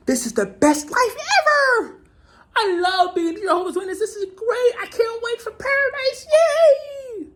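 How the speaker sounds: very high, gushing sing-song parody; mock the blissed-out true believer; mock cheerful believer. Mocking Jehovah's Witnesses praising their life, in a high gushing voice. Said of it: "always heard the term".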